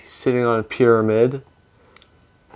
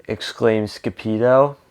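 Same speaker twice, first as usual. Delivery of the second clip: ordinary delivery, nothing marked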